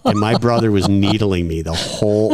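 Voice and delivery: ordinary talk, nothing marked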